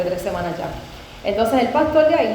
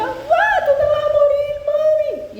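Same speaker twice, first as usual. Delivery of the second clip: very high-pitched imitation of a bawling child; imitated crying wail; slower than usual. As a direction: wail it high, mimicking a daughter bursting into tears. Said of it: "Y empieza a llorar".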